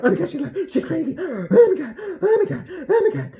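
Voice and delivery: high, loud hammed-up panic with gasps and laughing; mock freaking out. Shane reacting to Tati's video, hammed-up shrieking panic. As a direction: ham up a frantic Shane, gasping and shrieking. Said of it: "You'd be like".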